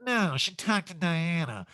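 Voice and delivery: lightly mocking, drawn-out correction; [name]. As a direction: stretch out a mild celebrity imitation. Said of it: "And [name] goes".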